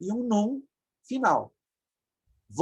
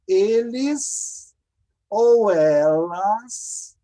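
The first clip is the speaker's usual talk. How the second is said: 1 drawn out; 5 dB louder, much slower than usual. Shown as a demonstration.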